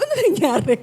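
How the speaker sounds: a loud, mildly alarmed ask; startled question. Retold or imitated. Preacher recalling his alarm at the crash, loud mock surprise. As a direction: play your own surprise at the noise. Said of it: "Sabi ko".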